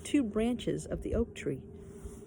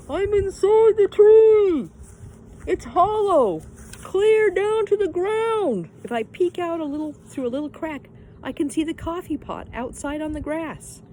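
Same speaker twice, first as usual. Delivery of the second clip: Pippi from inside the tree; 11 semitones higher, 11 dB louder than usual. A narrator's reading. Pippi calling up from inside the trunk, high and loud. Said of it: "Pippi's voice".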